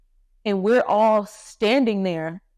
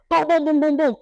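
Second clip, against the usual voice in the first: loud, high boom boom knocks; imitated banging; 8 semitones higher, 3 dB louder than usual. Retelling the knock on the door, loud imitated banging. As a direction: pound out the knocks with your voice.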